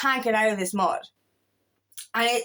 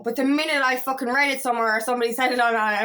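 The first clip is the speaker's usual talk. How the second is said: normal talking voice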